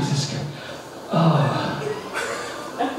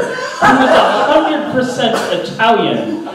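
ordinary talk, nothing marked

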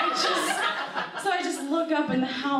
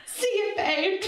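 high cheerful goodbye; 10 semitones higher, slower than usual. Retold or imitated. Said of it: "I'm like".

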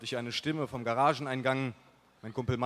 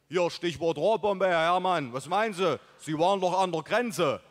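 loud, higher put-on buddy voice; rustic buddy Mike; 4 semitones higher, 5 dB louder than usual. A narrator's reading. Buddy recruiting his friend's father, loud put-on voice. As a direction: pitch the scheme like an eager pal.

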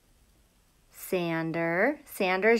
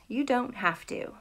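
whisper; 2 semitones lower, 9 dB quieter than usual. Real, not acted. Reassuring kids about getting wet.

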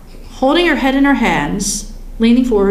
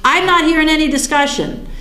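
higher-pitched mock objection; retold annoyance, pitched up; ticked off; 5 semitones higher than usual. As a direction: protest indignantly, as if raising a point of order. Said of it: "I was ticked off".

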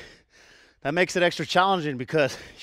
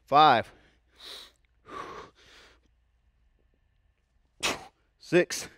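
counting out reps; much slower than usual. Real, not acted. Coach counting reps aloud.

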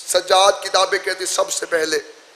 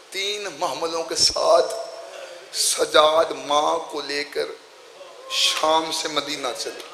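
slow mournful recitation; 4 semitones lower, 6 dB quieter, slower than usual. Acted.

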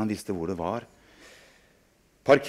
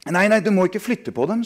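scared protest; 10 semitones higher, 5 dB louder than usual. Acted. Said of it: "sa jeg".

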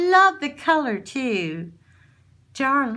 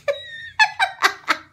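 high squealing laugh. Real, not acted. Laughs at dog.

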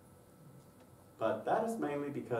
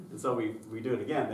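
ordinary delivery

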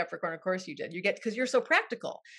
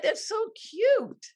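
much higher, slow, delighted; delighted aww; 10 semitones higher, 3 dB louder, much slower than usual. Real, not acted. Host delighted by chart research, much higher and slow. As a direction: stretch it out with delight.

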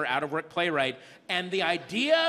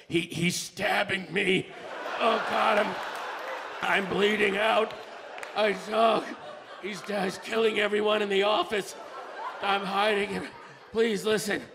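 dramatic victim. Pretend. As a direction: play a mock stabbing victim pitching timeshares. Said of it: "I should hear, like".

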